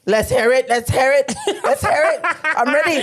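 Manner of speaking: egging her on through giggles; laughing eagerness. Real, not acted. Eager for accent demo.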